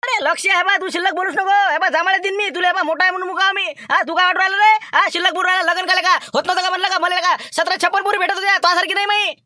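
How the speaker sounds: high, loud yelling throughout; furious shout. Acted. Man screaming at his brother-in-law mid-brawl, furious shout. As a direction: shout him down, all fury.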